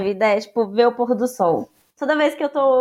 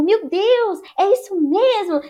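put-on excited kids; 8 semitones higher, slower than usual. Children's excited reaction voiced.

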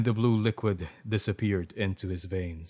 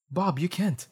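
higher, dismayed protest; pleading; 8 semitones higher than usual. A narrator's reading. Alexa pleading with Bob, dismayed. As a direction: beg him not to do it.

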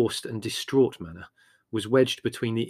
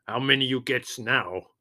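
put-on Greek accent; 2 semitones higher than usual. A narrator's reading. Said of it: "asked Spiro".

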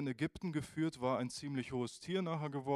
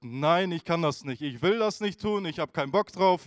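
loud, stubborn no from a reluctant Moses; loud refusal; 4 semitones higher, 9 dB louder than usual. Retold or imitated. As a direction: push back loudly, unwilling. Moses refuses God's call.

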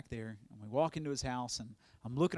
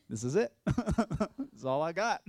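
laughing reply; 4 semitones higher, 3 dB louder than usual. Retold or imitated. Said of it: "retold reply about luggage".

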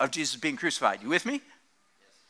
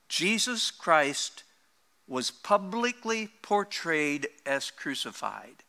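slow scripture quote. Retold or imitated. Preacher reading Paul's letter to the church, slow and softening. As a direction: read it out slowly, letting it sink in. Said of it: "He says".

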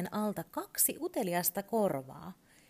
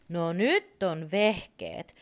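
loud, slow and delighted; excited; 2 semitones higher, 8 dB louder, much slower than usual. Acted. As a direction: relish the find, loud and drawn out. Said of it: "Turpo innostui".

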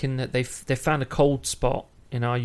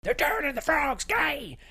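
mock rant voice; 13 semitones higher, 4 dB quieter than usual. Shouted quote of a conspiracy rant.

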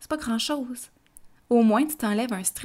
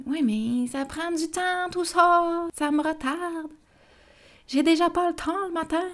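higher, exasperated protest; stressed objection; 8 semitones higher than usual. Retold or imitated. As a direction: complain there is never time. Imagined parent objecting about time.

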